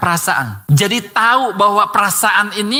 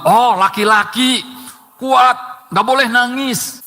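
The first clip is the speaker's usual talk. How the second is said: higher, mock-firm don't cry; a light parent voice hushing a crying boy; imitating a parent; 4 semitones higher, slower than usual. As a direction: play the parent telling a boy to be tough. Grandparent telling boy not cry.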